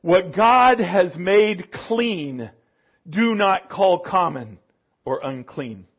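slow, measured pronouncement; a slow, grave divine rebuke; voice of Jesus. Acted. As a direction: lay it down slowly and solemnly. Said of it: "Jesus said".

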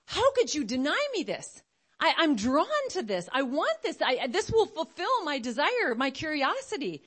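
high, melodramatic protest; indignant child. Acted. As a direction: plead like a child denied a treat. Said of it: "And the child may be thinking".

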